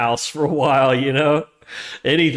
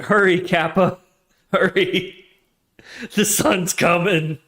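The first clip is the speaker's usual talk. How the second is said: urgent plea, higher and slow; a touch of desperate urgency; urgent movie quote; 6 semitones higher, 3 dB louder, slower than usual. Retold or imitated. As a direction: hurry them on like the sun is about to hit. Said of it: "that scene in Sunshine where it's like".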